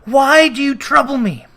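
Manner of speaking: high and loud, pleading; frightened outcry. Acted. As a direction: cry out at the ghost in dread. Scrooge terrified of the ghost.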